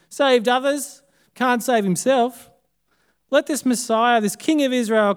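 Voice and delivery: scornful priests jeering at the crucified man; mocking jeer. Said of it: "mocked him among themselves".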